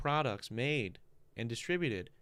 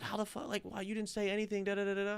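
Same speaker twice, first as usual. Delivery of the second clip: shocked; 8 semitones higher than usual. Retold or imitated. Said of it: "She was like".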